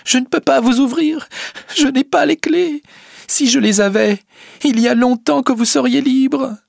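put-on very high, tearful young woman; acted sobbing. A narrator's reading. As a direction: wail helplessly through sobs. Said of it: "dit Rosa en éclatant en sanglots". (en sanglots, voix très aiguë)

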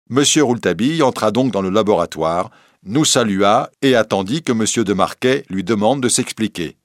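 plain reading voice

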